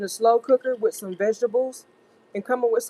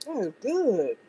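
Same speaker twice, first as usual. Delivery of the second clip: savoring delicious food. Real, not acted. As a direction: savor the taste as you say it. First bite of steak.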